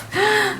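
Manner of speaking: high sudden gasp of alarm; sharp gasp. Real, not acted. Vlogger imagining her laptop thrown, sharp gasp. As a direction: gasp as if it's in danger.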